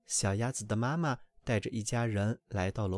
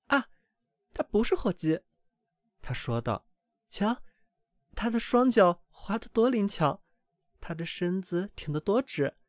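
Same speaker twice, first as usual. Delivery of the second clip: much higher, beaming mother hen; mother duck proudly leading her brood, higher; 7 semitones higher than usual. A narrator's reading. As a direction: show off your baby with pride.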